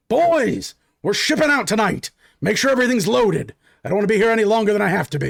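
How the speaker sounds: captain rallying his men to leave; calling out to the men. Acted. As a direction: shout it across the camp to the crew. Human calls out to his men.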